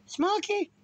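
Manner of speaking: high-pitched calling holler; repeated dog call. Retold or imitated. Brother-in-law repeats the name for lost dog, high call. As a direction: sing out the dog's name high. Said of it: "Say his name again like that".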